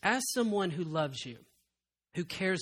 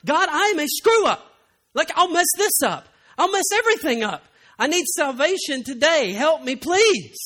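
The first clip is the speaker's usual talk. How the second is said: loud pleading prayer, slowing on help me please; fervent prayer; 8 semitones higher, 10 dB louder than usual. Acted. Pastor voicing his life's prayer to God, pleading loudly. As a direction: beg God for salvation. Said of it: "the prayer of my life".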